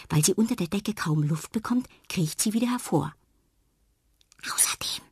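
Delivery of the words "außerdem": soft breathy whisper. A narrator's reading. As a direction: whisper to yourself bravely. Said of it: "flüstert sie". (flüsternd)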